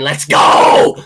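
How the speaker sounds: loud breathy rallying yell; hype shout. Real, not acted. Streamer hyping up viewer Joe, loud excited shout. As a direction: fire up the crowd.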